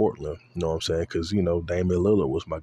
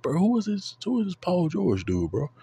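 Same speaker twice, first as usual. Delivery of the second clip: high, astonished question; amazed; 11 semitones higher than usual. Retold or imitated. Watching Paul George go off, an amazed high who. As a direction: ask it in disbelief, pitch way up. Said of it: "I'm like".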